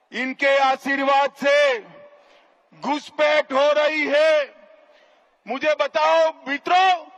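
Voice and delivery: booming political rally speech. Real, not acted. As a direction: boom it out to a packed rally.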